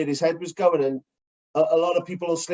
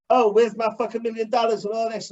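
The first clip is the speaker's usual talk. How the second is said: mock [name]; 6 semitones higher than usual. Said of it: "he's like".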